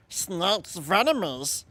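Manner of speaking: slow hissing snake voice; drawn-out snake hiss; snake impression. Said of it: "snake impression".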